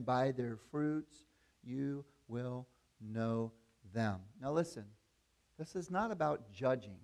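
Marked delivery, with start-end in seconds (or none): 0.0-4.2 "by their fruits you will know them" drawn out very slowly and deliberately; slow emphasis. Shown as a demonstration. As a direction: let each word land, slowly. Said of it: "here it is again".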